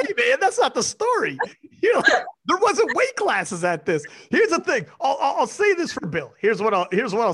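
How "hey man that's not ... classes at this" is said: higher, laughing mock retort; teasing imitation. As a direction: mimic her with a laugh.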